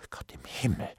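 barely voiced exclamation; whispered dismay. A narrator's reading. Bailiff sees his trouble, whispers in dismay. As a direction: let it out under the breath.

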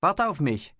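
lauter, höherer Zuruf; Rufen. A narrator's reading. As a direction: jemandem laut hinterherrufen. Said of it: "rief er". (laut rufend)

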